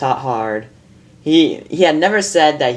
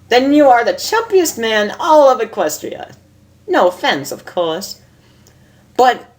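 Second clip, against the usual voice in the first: much higher, amused lady unicorn voice; a refined mare teasing her friend, higher; Rarity; 10 semitones higher than usual. Acted. Reads Rarity's lines in voice.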